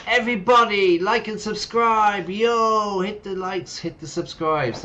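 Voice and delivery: loud, high hype-man yelling. Retold or imitated. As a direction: pump up the crowd. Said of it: "He's like the hype man".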